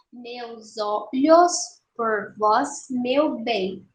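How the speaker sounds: "Meus olhos por vós, meu bem" slowly in separate syllables; escansão silábica. Shown as a demonstration. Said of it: "vamos fazer a escansão". ("Meus olhos por vós, meu bem" devagar, separando as sílabas)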